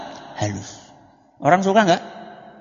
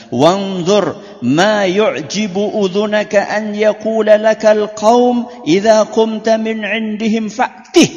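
high, slow, raised Arabic recitation; hadith recitation; 8 semitones higher, 7 dB louder, slower than usual. Acted. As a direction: intone the Prophet's words slowly and high. (melantunkan sabda Nabi dengan nada tinggi dan lambat)

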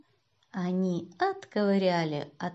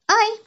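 high, loud "ow"; yelp of pain; 13 semitones higher, 7 dB louder than usual. A narrator's reading. Boy under the seat, pained yelp. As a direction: cry out as if stepped on. Boy's hand trodden on.

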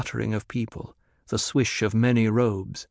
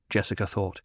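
plain reading voice

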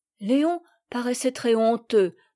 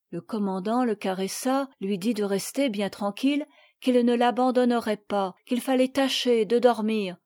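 ordinary delivery

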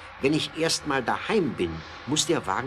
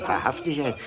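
normal talking voice

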